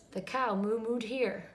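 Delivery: ordinary delivery, nothing marked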